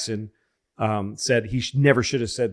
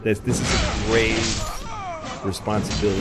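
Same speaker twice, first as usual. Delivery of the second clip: grave presidential voice. Retold or imitated. Voicing Reagan's solemn signal.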